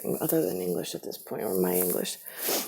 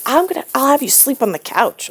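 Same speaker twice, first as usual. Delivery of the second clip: threatening mother; 8 semitones higher, 6 dB louder than usual. Retold or imitated.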